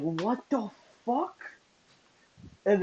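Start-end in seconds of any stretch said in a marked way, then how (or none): 0.0-1.4 soft, high, drawn-out shock; retold hushed astonishment; stunned disbelief. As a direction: draw it out slowly, pitched up in disbelief. Retelling shock at friend being tased.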